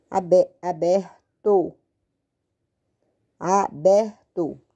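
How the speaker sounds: aberto drawn out in separate syllables, a-ber-to; slow syllable by syllable. Shown as a demonstration. Teacher writing the word aberto, slow syllables. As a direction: break aberto up slowly as if dictating.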